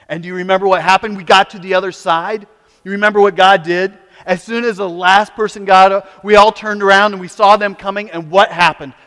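fast, loud, high preaching; preaching at full force. Acted. Preacher retelling the Exodus to the congregation, at full force. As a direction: sweep them along in the story.

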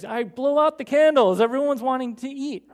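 loud, high, impatient urging. Retold or imitated. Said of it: "he impatiently says".

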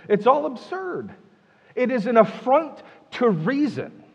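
high, slow, exasperated declaration; frustrated. Acted. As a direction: act out a wise man's exasperation. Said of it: "Can you feel his frustration?".

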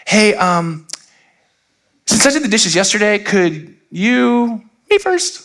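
high, overly nice wheedling; sugared request. Retold or imitated. Adult nicely angling to go first, high fake-sweet voice. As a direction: ask ever so nicely, pitch climbing to the end. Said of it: "We do it more nicely".

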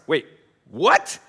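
mock disbelief. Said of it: "to express".

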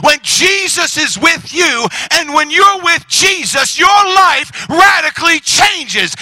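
loud, high, drawn-out proclamation; full-force preaching. Real, not acted.

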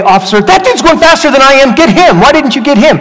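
high, loud outburst at the officer; indignant protest. Acted. Speeding driver complaining to officer, high indignant protest.

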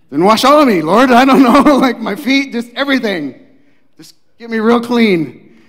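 hammed-up overeager plea; joking Peter. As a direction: Peter disrobing, begging for a full wash. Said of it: "Matt and I were joking".

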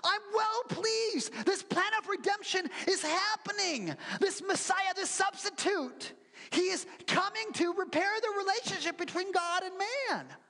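high joyful God voice; overjoyed God. Acted. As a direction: proclaim it like a thrilled father. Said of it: "God is like".

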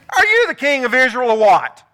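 loud scornful Jezebel jeering at her husband; demanding scorn. Retold or imitated. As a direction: belittle him, pushing hard. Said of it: "Jezebel demanded".

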